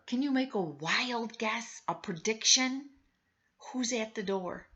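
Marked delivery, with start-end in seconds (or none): none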